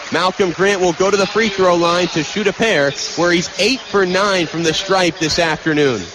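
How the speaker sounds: ordinary talk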